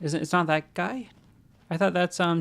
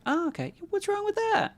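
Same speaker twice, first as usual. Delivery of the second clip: squeaky, much higher mocking tone. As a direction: parrot chat back in a squeak. Mocking chat in a high voice.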